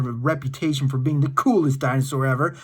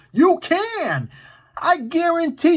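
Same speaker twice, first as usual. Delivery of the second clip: excited; 11 semitones higher than usual. Real, not acted. Hyping kids about finding dinosaurs.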